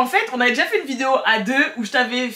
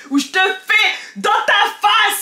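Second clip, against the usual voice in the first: taunting shout; 8 semitones higher, 3 dB louder, slower than usual. Real, not acted. Taunting her opponent.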